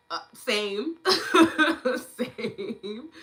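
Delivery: laughing agreement. Real, not acted. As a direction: burst out laughing as you agree. Laughs agreeing about a pity party.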